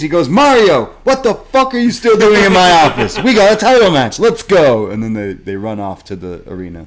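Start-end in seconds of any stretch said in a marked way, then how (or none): 0.3-4.9 mock yell. Professor yells at lingering student.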